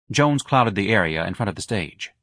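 ordinary delivery

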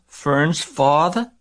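high, slow, mildly tearful question; drawn-out disbelief with a touch of sob; sobbing. A narrator's reading. Pig learns Fern's father is involved, high understated sob. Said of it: "sobbed Wilbur".

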